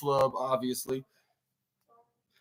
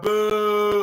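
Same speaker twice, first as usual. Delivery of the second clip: long sustained higher "boo"; imitated booing; 4 semitones higher than usual. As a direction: hold the boo on one raised note. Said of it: "this is what fans are booing".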